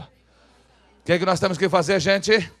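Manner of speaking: slow, loud question to the crowd. Shown as a demonstration. Preacher asks the congregation what they must do, loud question. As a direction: project the question for all to repeat. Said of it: "Fala bem alto aí".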